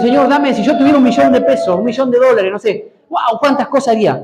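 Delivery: mock greedy plea. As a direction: imitate someone begging God for money. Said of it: "Algunos dicen". (súplica burlona, más aguda, soñando con dinero)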